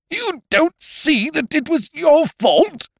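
very high, loud, slow incredulous echo; loud, drawn-out outraged question; outraged sputter. A narrator's reading. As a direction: throw it back in high-pitched disbelief. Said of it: "stuttered Ukridge".

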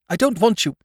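high, tense refusal with stress on dont; frantic refusal. Acted. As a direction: hold back from screaming, dont pressed hard. Sister rejects brother.

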